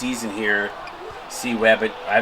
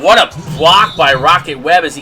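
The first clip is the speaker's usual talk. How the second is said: loud, higher, amazed sports call; excited play-by-play call; 5 semitones higher, 7 dB louder than usual. Real, not acted. Commentator marvels at Rocket Webb's flying block, excited call. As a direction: punch the big play with rising energy.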